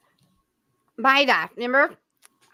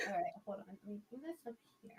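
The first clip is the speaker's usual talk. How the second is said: whispered, quick aside; muttering to self; 3 semitones lower, 22 dB quieter, much faster than usual. Real, not acted. Host setting up the next slideshow, muttering quietly. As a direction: whisper it under your breath.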